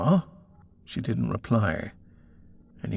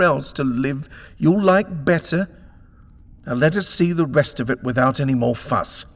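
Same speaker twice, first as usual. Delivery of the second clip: loud, high, domineering scolding; angry tirade; 8 semitones higher, 8 dB louder than usual. Acted. Husband berates the landlady in a loud angry tirade. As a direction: shout her down with rising fury.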